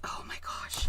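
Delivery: a breathy whispered oh my gosh; whispered dismay. Real, not acted. Crafter whispering dismay at a gooey platform. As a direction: hush it out in sudden dismay.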